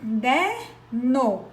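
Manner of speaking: "Caderno" drawn out very slowly, each syllable apart; syllables split. Shown as a demonstration. Teacher repeating the word for the class, slow and split. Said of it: "tem três sílabas".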